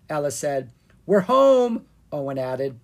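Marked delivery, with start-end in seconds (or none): 1.0-1.8 loud, high announcement; calling out. Acted. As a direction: sing out that you're home. Kids announcing arrival.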